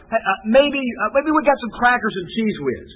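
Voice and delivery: high, hopeful mock husband; husband hopefully offering crackers and Cheez Whiz; mock husband suggestion. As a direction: offer the crackers with a sheepish lift. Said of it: "it says".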